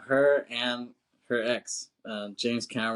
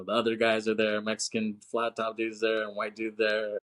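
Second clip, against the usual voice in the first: ordinary talk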